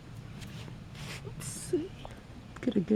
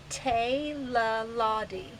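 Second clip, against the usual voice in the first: Teilaladi drawn out slowly, syllable by syllable; stretched-out, careful Teilaladi; slow teaching pronunciation; slower than usual. Shown as a demonstration. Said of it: "the word we're gonna learn today".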